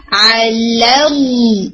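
علی very slow, its ل thin and light; light lam in علی. Shown as a demonstration. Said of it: "صرف لفظ الجلالہ کا لام heavy". (علی کا لام ہلکا، کھینچ کر)

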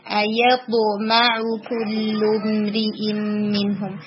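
very slow ایطمع with a strong heavy ط; teacher recites slowly, stressing the heavy letter; heavy letter demo. Shown as a demonstration. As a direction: drag ایطمع کل امرئ منہم out, ط full. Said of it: "یہاں پہ با کو اور strong رکھنا ہے".

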